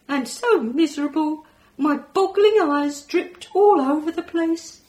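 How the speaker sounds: high, loud, gleeful alien voice with a laugh; excited alien. Acted. Alien thrilled to hear the kids clapping, loud high glee. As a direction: burst out delighted like a space creature.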